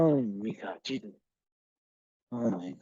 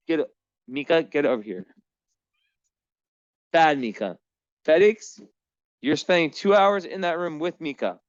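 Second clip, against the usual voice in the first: scolding cats; 6 semitones higher than usual. Real, not acted. Owner punishing her cats for fighting, high raised scolding. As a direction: tell off naughty pets in a high raised voice.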